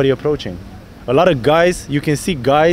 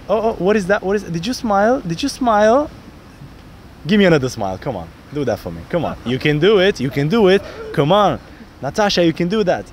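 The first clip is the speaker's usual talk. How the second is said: playful coaxing; 3 semitones higher than usual. Shown as a demonstration. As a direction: cheer her on, playful and rising. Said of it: "And then I'm like".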